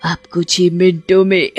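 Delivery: scornful supernatural villainess; menacing ghost serpent woman. Acted. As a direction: taunt and threaten your trapped prey. (धमकाती भूतिया नागिन)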